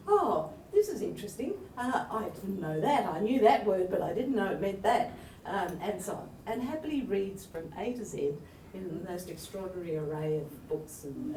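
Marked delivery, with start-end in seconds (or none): 0.1-5.1 louder, imitated interest in a find; intrigued discovery. Editor imitating the poet emerging from the dictionary, intrigued. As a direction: report little discoveries with emphatic interest. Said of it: "emerges every now and then to say".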